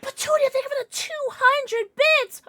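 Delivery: high, loud squeal of thanks; excited thanks. Real, not acted. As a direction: gush over the donation. Thanking a donor on stream.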